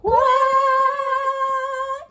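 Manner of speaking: high singsong giggle. Real, not acted. Host laughs after the silly quacking. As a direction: giggle at the silliness.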